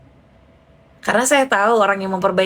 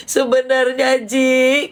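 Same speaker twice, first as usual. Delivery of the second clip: tearful friend; 3 semitones higher, slower than usual. Retold or imitated. Sobbing friend begins to confide.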